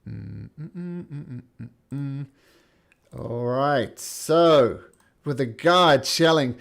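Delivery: ordinary talk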